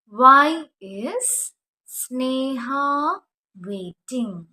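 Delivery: drawn out very slowly, loud start; slow model sentence. Shown as a demonstration. Teacher modelling the question for students, very slow. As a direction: stretch every word for the class. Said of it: "ചോദിക്കേണ്ട കാര്യം ചോദിക്കാം".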